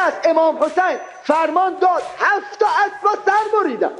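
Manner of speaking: rising, shouted pulpit climax; preaching at full force. Acted. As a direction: drive the story to its peak for the crowd.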